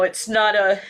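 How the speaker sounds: ordinary talk, nothing marked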